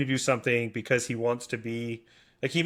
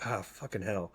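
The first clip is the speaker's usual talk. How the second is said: low, much softer curse under the breath; frustrated muttered curse; 5 semitones lower, 11 dB quieter than usual. Retold or imitated. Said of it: "lately I've been getting really frustrated".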